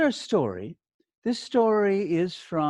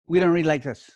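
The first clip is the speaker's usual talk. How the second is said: words pushed together; 4 dB louder, faster than usual. Shown as a demonstration. Teacher demonstrating bad reading to the class, words run together. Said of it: "Pushing all the words together".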